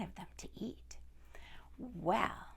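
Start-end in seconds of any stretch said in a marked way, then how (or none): 1.8-2.5 whispered well; a soft suspenseful whisper; hushed storyteller's aside. A narrator's reading.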